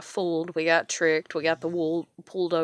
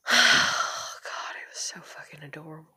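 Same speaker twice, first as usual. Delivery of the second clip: breathy whispered words choked with tears; real crying, whispered much softer; tearful whisper; 4 dB quieter than usual. Real, not acted. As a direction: barely get it out while crying. Recalling her daughter singing.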